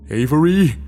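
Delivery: loud, sharp shout. A narrator's reading. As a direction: bark the name out. Shouting her name.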